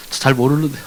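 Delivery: a hint of a timid believer; meek reply. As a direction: answer like a caught schoolboy.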